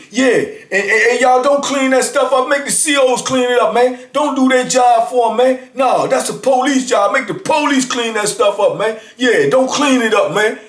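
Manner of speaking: hammed-up jailhouse agitator; mock shouting. Inmate hollering that cleaning is the police's job, mock shout. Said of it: "Then he turned around and say".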